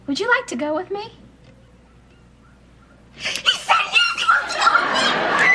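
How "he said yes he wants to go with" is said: very high, shouting with joy; excited squeal. Real, not acted. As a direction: squeal it out, over the moon. Thrilled after the boy says yes.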